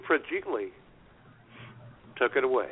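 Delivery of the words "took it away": normal talking voice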